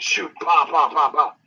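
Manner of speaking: imitated gunfire pops; gunshot pops. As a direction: punch out each pop like a shot.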